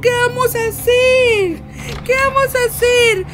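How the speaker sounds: high, loud frightened shouts; panicked shouting. Real, not acted. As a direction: cry out in panic. Bebé endemoniado otra vez. (gritos de pánico)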